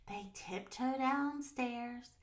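slow, soft tiptoe narration; hushed, creeping. A narrator's reading. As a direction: narrate as if sneaking along.

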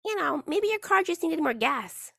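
high, slow imitation of a niece. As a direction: lightly voice a child offering a theory. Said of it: "She's like".

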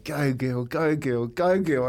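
retold race cheer, climbing each time; cheering the horse on. Retelling cheering his horse home in the winners' room, building. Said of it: "I get a little bit loud in there".

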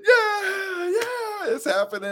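high, loud cheering yells. Retold or imitated. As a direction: cheer like the crowd at the buzzer. Imitating fans cheering at the buzzer.

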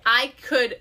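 ordinary delivery

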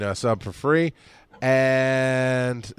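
ordinary talk, nothing marked

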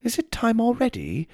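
put-on high, surprised British accent; a light British accent, surprised. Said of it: "the sound of his British accent".

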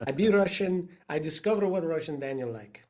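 a light, hammed-up fake Russian accent; mock Russian accent. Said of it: "I do wanna do Russian".

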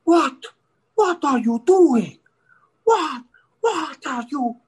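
high, loud cop impression; angry foreign cop. Dutch cop confronting a driver, high loud mock demands. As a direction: bark slow questions as a mock policeman. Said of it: "the cop comes up and he's like".

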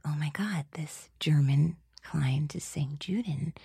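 hushed shocked whisper. Retold or imitated. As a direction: whisper it in alarm. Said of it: "And I was like".

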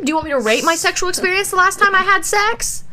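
high-pitched, sarcastic questioning; mock indignant. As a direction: fire back in exaggerated outrage. Acted indignant retort to nosy texter.

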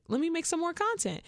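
eager self-quote. Retold or imitated.

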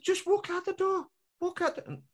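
exasperated high pleading. Retold or imitated. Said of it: "I said".

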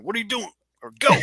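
imitating the broadcaster's Southern hillbilly accent; mock hillbilly accent. Mimicking the announcer's Southern hillbilly accent. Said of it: "in his little hillbilly accent".

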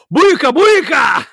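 high, loud slow yell across the shop; yelled catchphrase. Retold or imitated. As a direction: holler it at a coworker. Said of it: "and just yell".

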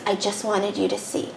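softly, a hushed line close to her ear; the masked man whispers to Alicia, soft and hushed; whispering. Retold or imitated. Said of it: "and whispers".

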